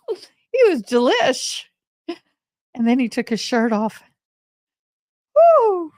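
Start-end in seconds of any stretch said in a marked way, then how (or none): 0.0-1.7 much higher, slow, laughing and gushing; swooning laugh. Real, not acted. Telling the audience about the hunk, giggly swoon. 5.4-5.9 much higher, much louder woo; excited whoop. Real, not acted. Recalling the cowboy taking his shirt off, a loud whoop.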